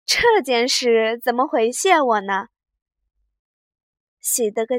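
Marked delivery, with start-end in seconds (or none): 0.0-2.5 teasing smile. A narrator's reading. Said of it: "晃着头笑道".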